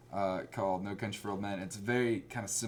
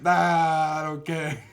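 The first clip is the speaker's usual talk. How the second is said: loud, drawn-out mock refusal; dismissive husband; 4 semitones higher, 8 dB louder, much slower than usual. Husband shrugging off his ex-wife's complaint, loudly dismissive. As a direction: brush her off, slow and loud. Voicing husband answering the ex-wife.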